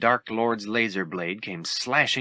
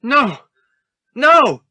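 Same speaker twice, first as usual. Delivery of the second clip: much higher, louder horrified scream; horrified shout; 11 dB louder than usual. Acted. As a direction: scream in horror. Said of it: "Luke shouted".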